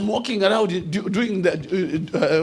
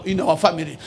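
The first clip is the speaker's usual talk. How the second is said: usual speaking voice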